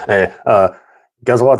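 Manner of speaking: rude voice. Retold or imitated. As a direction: needle them like a cocky pirate. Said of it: "such a rude voice".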